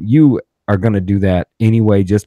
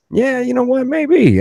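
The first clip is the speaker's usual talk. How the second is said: way up high, sarcastic sing-song yeah; mock agreement; slower than usual. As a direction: fake going along with it. Mock-agreeing with arrogant podcaster's advice.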